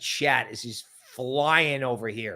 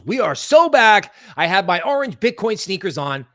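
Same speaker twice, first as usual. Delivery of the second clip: everybody goes; 4 semitones higher, 6 dB louder than usual. Retold or imitated. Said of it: "Everybody goes".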